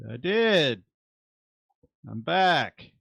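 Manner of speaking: short, high holler in answer; calling back. Acted. Shane answering Howard down the stairwell, high call. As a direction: call the reply down to someone out of sight.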